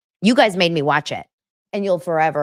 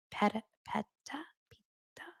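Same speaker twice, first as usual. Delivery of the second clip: breathes the name under her breath; unsure whisper; 6 semitones higher, 14 dB quieter than usual. Real, not acted. Vlogger whispers the dancer's wife's name, unvoiced whisper. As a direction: whisper it while checking the pronunciation. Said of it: "Don't wanna say it wrong".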